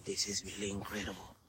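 hushed, tense whisper; breathless scared whisper. Real, not acted. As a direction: whisper like someone might hear. Whispering about scary people.

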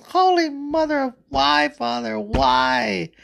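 very high, anguished cry; agonized wail. Acted. Adam losing a rib, crying out to God in pain. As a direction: wail up high in hammed-up agony. Adam's rib being removed.

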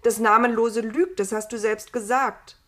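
loud, emphatic argument; exclaimed protest. A narrator's reading. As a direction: argue back forcefully, raising the volume. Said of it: "rief Adam". (laut protestierend)

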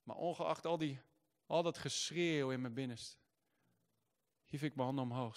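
normal talking voice

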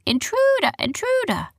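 very high squawky parrot alarm; parrot shout. A narrator's reading. Parrot about to raise the alarm, high squawked shout. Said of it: "about to shout".

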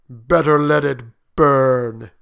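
slow, loud, weighty warning; solemn. Acted. Soldier warns his friend off the burning paw, solemnly. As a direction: weigh each word solemnly. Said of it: "said the soldier solemnly".

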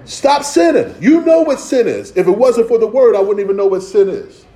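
high, loud preaching rebuke; forceful exhortation. Acted. Preacher telling the congregation to stop sinning, forceful rebuke.

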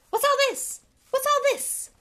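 very high, loud busybody call; booming theatrical interruption. Acted. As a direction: ring it out twice while striding up. Lockhart calls out as he arrives.

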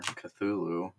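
ordinary talk, nothing marked

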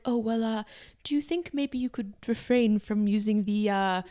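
hesitant, soft British-dad imitation; awkward British accent. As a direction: tiptoe through a request in British English. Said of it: "he was so awkward".

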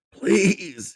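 high, loud desperate plea; begging. Acted. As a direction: beg for your life. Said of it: "he begged".

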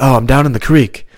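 high, loud mock distress call; client in a flashback. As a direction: call for help as if reliving combat. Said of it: "having flashbacks".

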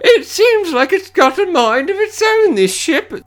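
put-on high, slow old-lady voice; imitated old lady. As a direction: put on a high-pitched old woman, drawn out. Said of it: "then the old lady's like".

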